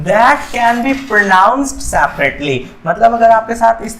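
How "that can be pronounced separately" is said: reads the definition very slowly; slow reading. Shown as a demonstration. Teacher reads the question to students, very slow. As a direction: spell out each word for the class. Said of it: "देखिए कहता है".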